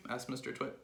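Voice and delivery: ordinary delivery, nothing marked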